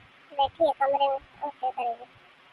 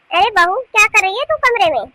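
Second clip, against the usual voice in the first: high, loud call to another room; 5 semitones higher, 6 dB louder than usual. Acted. Mother-in-law calling the younger bahu from her room, raised voice. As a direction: raise it to summon someone.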